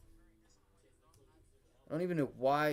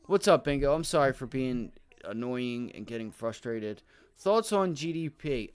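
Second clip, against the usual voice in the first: usual speaking voice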